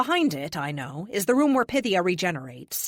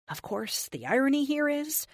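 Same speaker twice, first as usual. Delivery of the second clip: ordinary delivery, nothing marked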